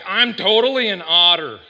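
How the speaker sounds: loud, mock-proud announcement; proud declaration. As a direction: belt it out like a boast. Acts proud animal-test taker.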